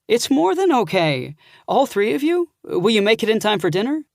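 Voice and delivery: excited hostess planning dinner; eager aunt. Acted.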